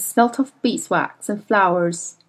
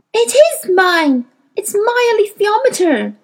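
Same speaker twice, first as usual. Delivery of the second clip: indignant protest; 12 semitones higher, 5 dB louder than usual. A narrator's reading. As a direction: insist furiously that it is hers. Lyra protests her ownership.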